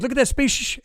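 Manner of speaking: high-pitched astonished exclamation; imitated shock. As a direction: mimic her amazement at a sudden sighting. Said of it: "she's actually shocked".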